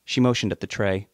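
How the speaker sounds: ordinary delivery, nothing marked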